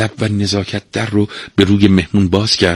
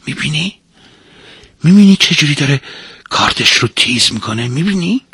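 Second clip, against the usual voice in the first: high, rising insistent questions; alarmed warning; 7 semitones higher than usual. Acted. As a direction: press the guest to look at the danger. Pointing out the knife to guest.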